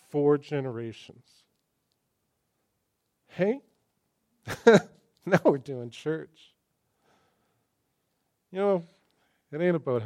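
loud, slow, joyful proclamation; loud, delighted preacher's cry; excited. Real, not acted. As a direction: ring it out like good news. Celebrating a four-generation dedication.

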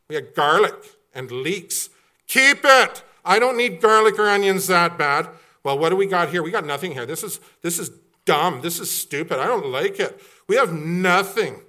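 whiny complainer. Acted. Preacher voicing Israelites whining about food. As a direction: gripe like a spoiled traveler.

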